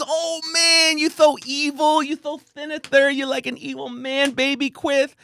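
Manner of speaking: hammed-up high lisp, playful; lisping Tyson impression. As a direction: tease in a high lisping boxer voice. Tyson teasing quote.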